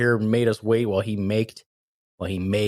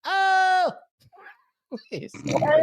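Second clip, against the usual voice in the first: laughing squeal; much slower than usual. Real, not acted. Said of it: "so hard to do it badly".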